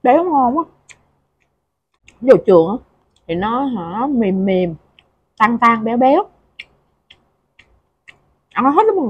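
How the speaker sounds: food in mouth; chewing while talking. Real, not acted. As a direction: mouth partly full. Seen 3: Bites food at -1.0; lips pressed, chewing motions while speaking.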